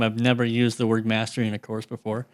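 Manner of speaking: ordinary talk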